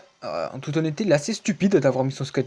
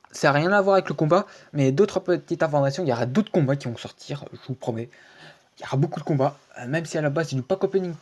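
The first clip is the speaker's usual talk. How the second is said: ordinary delivery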